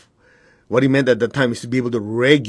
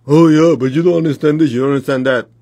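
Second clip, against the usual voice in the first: mocking the doubting mind; 2 semitones higher, 6 dB louder than usual. Teacher mocking a student's excuses, whiny and loud. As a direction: mimic a stubborn excuse-maker. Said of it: "I don't care what your mind tells you".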